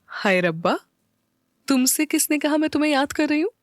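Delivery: high, playful denial; coy smiling protest; smiling, teasing. A narrator's reading. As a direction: tease him with a smile. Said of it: "मुस्कुरा कर बोली".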